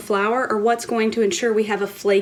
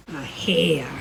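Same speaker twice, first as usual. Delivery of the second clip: lower, odd accent on "A hair"; mock accent; 4 semitones lower than usual. Shown as a demonstration. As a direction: another try at a strange accent. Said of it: "what kind of poor accent that was".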